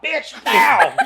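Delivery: high, loud hammed-up yell; mock angry shout. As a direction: bark it like a comic bully dad. Acting abusive dad joke.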